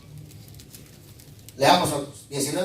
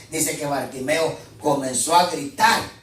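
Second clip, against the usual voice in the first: normal talking voice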